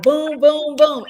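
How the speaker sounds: emphatic, loud and high rapid-fire delivery; triumphant retort. Retold or imitated. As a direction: fire each word off like a ready answer. Triumphant retort to title company.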